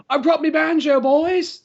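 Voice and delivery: high-pitched, put-on banjo player voice; high mock character voice announcing himself; mock eager bandmate. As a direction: pipe up high like the hopeful tagalong. Acting out the sidelined banjo player.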